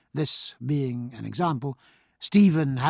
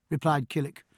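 ordinary delivery

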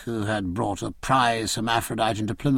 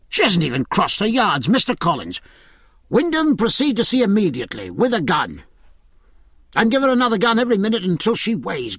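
angry shouted orders; 7 semitones higher, faster than usual. Acted. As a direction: bark the orders at the lagging ship. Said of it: "he cried".

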